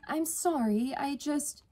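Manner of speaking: high, hesitant apology; apologetic. A narrator's reading. Sister apologising to annoyed sibling, apologetic.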